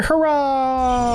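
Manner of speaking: high, long drawn-out hurrah; celebratory cheer. Pretend. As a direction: cheer it out, holding the last vowel. Mock-grand cheer closing the segment.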